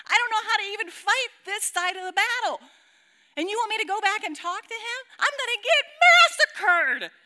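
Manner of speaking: high, loud, exasperated outburst; panicked protest. Acted. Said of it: "Then I start debating the Holy Spirit".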